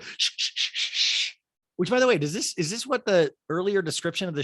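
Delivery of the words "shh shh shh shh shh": repeated long shushes; imitated urgent shushing. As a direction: hush a noisy room, over and over. Imitating Crystal shushing everyone.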